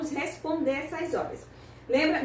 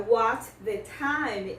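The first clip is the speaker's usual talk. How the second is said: slow British model; 2 semitones lower, much slower than usual. Shown as a demonstration. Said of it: "Só que essa estrutura".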